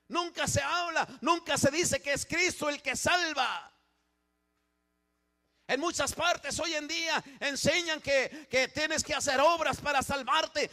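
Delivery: loud, driving sermon climax with a shout; fiery preaching. Acted. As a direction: thunder it at the congregation. Preaching at full force.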